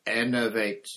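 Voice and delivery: spell. A narrator's reading. Dumbledore reviving Crouch with his wand, firm spell. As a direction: cast it firmly. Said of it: "pointed his wand at the man's chest and said".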